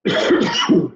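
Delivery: a short, dry cough to clear a frog in the throat. Real, not acted. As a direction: cough once, harshly, mid-sentence. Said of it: "I've got a frog in my throat".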